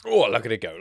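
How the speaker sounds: normal talking voice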